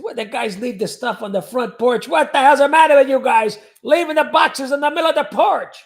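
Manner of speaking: pretending to be grandpa in the garage; grandfather yelling. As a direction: gripe like an angry grandpa. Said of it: "This is my grandfather".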